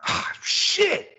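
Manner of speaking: put-on high-pitched kid blurting a curse; imitated kid cursing. Kid cursing off in the distance while shoveling, high imitated yelp. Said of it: "I hear one of the kids go".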